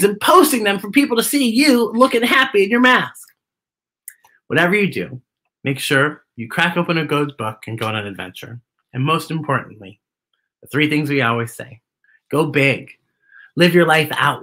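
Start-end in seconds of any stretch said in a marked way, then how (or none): none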